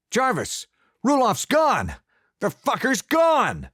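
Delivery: very high, loud alarm call; alarmed shouting. A narrator's reading. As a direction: rouse the house. Thomas raising the alarm.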